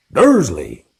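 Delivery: loud booming call; boomed. Acted. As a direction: boom the name. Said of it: "he boomed".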